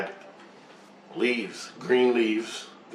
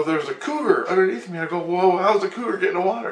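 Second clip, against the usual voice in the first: higher, mildly alarmed quote; retold alarm; 7 semitones higher, faster than usual. Dreamer reporting a cougar underneath, mock alarm. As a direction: act out spotting danger below, pitched up.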